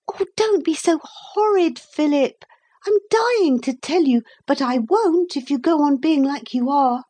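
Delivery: put-on high-pitched, insistent girl's voice; high girl bursting to tell; pleading girl. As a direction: plead like an exasperated little girl. Narrator voices girl Lucy pleading.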